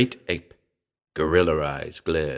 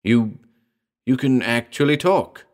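higher, halting amazement; astonished gasp; 4 semitones higher than usual. A narrator's reading. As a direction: stammer it in mild astonishment. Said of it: "I gasped".

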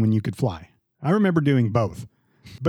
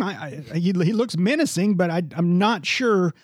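ordinary delivery

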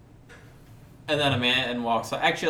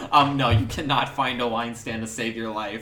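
ordinary talk, nothing marked

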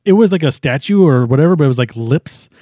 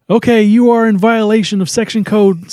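higher-pitched official warning; Ray; 7 semitones higher than usual. Retold or imitated. Ray warning the goddess with a code violation, officious. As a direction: read out the violation in a raised, formal voice. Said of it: "Ray, he says".

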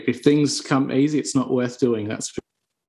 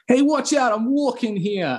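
high, hammed-up New York street accent, slowing at the end; 10 semitones higher, slower than usual. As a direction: imitate a New Yorker shouting at a passer-by. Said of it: "So New York".

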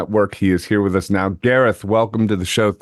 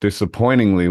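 ordinary delivery

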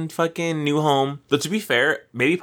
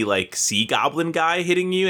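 ordinary talk

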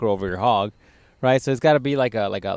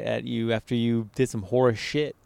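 normal talking voice

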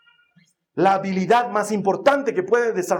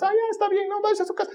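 high mocking voice of staff waving you off; breezy, mocking brush-off; mock dismissive official; 13 semitones higher, faster than usual. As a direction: airline staff saying go home.

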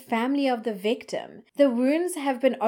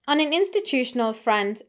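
usual speaking voice

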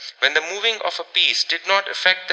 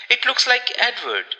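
higher-pitched fearful outburst; frenzied fear; 7 semitones higher than usual. A narrator's reading. Rebecca bursting out about the shadow, in frenzied fear. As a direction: burst out with understated panic. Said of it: "burst out Rebecca in a sort of frenzy of fear".

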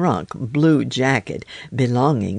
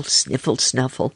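plain reading voice

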